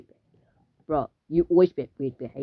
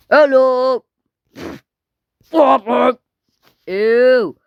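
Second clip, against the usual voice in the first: high, loud play voice; pretend calling out; 8 semitones higher, 8 dB louder, much slower than usual. Calling to someone in pretend play, high shout.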